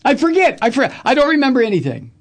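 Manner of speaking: high, fast mock witness, I forget; mock forgetful witness. Host mocks a witness who can't remember, high and fast.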